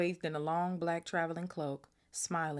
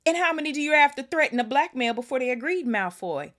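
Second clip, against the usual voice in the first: roared, far higher and much louder; roaring; 10 semitones higher, 13 dB louder than usual. A narrator's reading. Hagrid roaring at Malfoy over blackmailed governors, furious bellow. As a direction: bellow it at the smug visitor. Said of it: "he roared".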